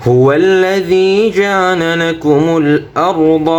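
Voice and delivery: melodic recitation with held vowels; Quran recitation. Shown as a demonstration. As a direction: recite slowly and sing the line. Model recitation.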